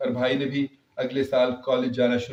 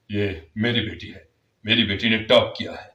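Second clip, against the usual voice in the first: lower, beaming fatherly pride; proud father; 5 semitones lower than usual. Acted. Father to relatives, lower and proud. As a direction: show off his daughter. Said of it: "سب کو فخر کے ساتھ بتاتے".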